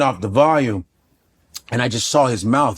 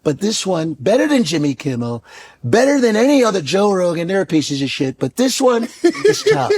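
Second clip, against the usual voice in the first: higher, put-on Shannon Sharpe voice, laughing through it; lisping Shannon Sharpe raving about the show; Shannon Sharpe impression; 3 semitones higher than usual. Comedian imitating Shannon Sharpe praising the host's show.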